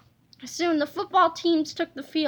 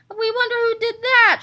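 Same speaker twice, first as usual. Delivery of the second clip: shouting; 6 semitones higher, 5 dB louder than usual. Acted. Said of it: "shouted the fans in the bleachers".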